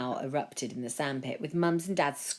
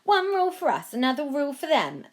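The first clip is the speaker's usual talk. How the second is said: higher, louder resentful jab; resentful crowd; 10 semitones higher, 6 dB louder than usual. A narrator's reading. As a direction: jeer it bitterly. Another person complains.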